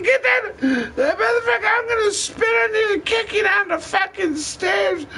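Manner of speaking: very high shrieking mock yell of an angry woman; imitating screaming partner. Imitating his partner yelling at him over the snake, high mock shriek. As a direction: shriek it like the furious girlfriend.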